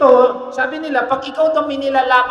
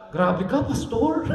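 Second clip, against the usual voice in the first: mock offended; slower than usual. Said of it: "Ano kaya sasabihin niyo sa akin?".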